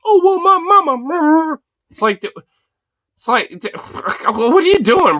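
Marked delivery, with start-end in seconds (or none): 0.1-1.6 put-on high, slow, whiny mama's boy voice; mock the vice president as a mama's boy. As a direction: simper it like a clingy little boy. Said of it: "But you're like".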